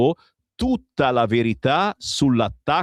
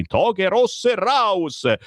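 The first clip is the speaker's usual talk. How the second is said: high, drawn-out slogan cry; mock yell; 7 semitones higher, much slower than usual. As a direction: stretch out the mock rallying cry. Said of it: "urlano insieme la Meloni e Salvini".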